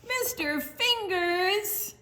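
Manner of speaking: high, slow delighted Mister Fingers; excited reveal. Acted. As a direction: announce him with delight. Excited recognition in story.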